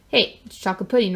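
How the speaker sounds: ordinary delivery, nothing marked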